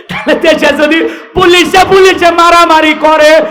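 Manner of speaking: high, loud rhetorical oratory; full-force preaching. Acted. Preacher questions the police's conduct, loud and impassioned. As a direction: ring out each clause to the crowd.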